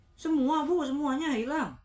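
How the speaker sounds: loud, high shocked question; stunned boy questioning his mother. A narrator's reading. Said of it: "Ardi terkejut".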